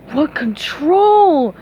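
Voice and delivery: slow, dopey awed voice; slow awestruck starfish; marveling Patrick. Acted. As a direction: marvel slowly like a dim starfish. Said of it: "Patrick marveled".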